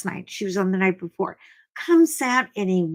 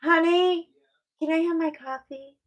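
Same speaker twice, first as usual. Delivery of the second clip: higher sing-out request; calling to partner; 7 semitones higher than usual. Real, not acted. Host asking honey for coffee, calling out higher. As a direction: call sweetly off to someone for a favor.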